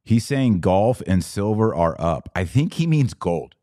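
usual speaking voice

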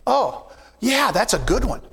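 ordinary talk

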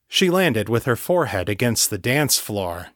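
usual speaking voice

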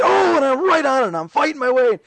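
high, charging battle excitement; hammed-up gung-ho gamer cry; mock gamer frenzy. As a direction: yell like you're storming into the fight. Mimics himself excited playing the game.

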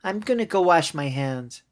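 put-on a child simply announcing what she's doing; lightly voiced high child; little girl. Voicing little girl Clara.

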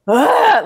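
shrill-free high groan of disgust, mock; a sharp high ugh of disgust. Reading his email, a high mock groan of disgust. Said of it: "I'm like".

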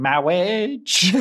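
high, drawn-out comic voice; imitated film quote. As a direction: stretch the word out in a silly put-on voice. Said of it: "my kids will suddenly start going".

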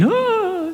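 high mock gasp of shock; a hammed-up falsetto swoon. Mocking the shocked bystanders with a high swooning gasp. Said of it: "They're swooning".

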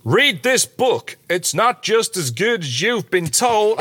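put-on slow, drawn-out Southern accent. Reading a glowing review quote aloud, Southern accent. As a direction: read it as a slow Southern drawl. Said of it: "I don't know why I keep doing Southern".

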